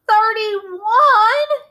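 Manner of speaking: high anguished wail. Acted. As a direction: wail it out in despair. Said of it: "he wailed".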